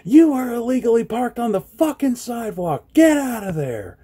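high, angry yelled command; retold yell. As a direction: yell it at the driver again. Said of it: "so I yelled out".

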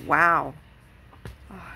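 usual speaking voice

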